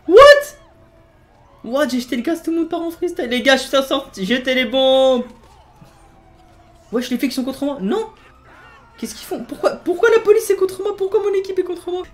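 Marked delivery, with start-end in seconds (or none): 0.1-0.6 very high, loud squeal of laughter; laughing shriek as his own team turns on him. Real, not acted. Shocked as chaos erupts. 7.2-12.0 very high, laughing disbelief; outraged squeal. Real, not acted. Own police turn on him.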